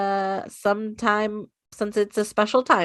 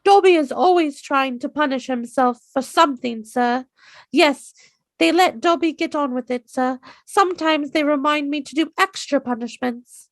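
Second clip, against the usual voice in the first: higher, eager house-elf voice, toned down from the squeak; a more controlled Dobby, still high; better Dobby; 6 semitones higher than usual. Retold or imitated. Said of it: "That one was a lot better".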